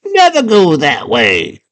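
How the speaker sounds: very high, stretched-out warning; little worm voice. Acted. Worm warning the girl off, high drawn-out creature cry. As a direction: warn slowly as a tiny high-voiced worm. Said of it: "He's like".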